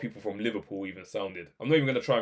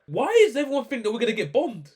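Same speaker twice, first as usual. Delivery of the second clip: high-pitched, louder incredulous question; baffled, raised-voice outburst; exasperated disbelief; 12 semitones higher, 6 dB louder than usual. Real, not acted. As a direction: demand an explanation, voice shooting right up. Exasperated reaction to doom predictions.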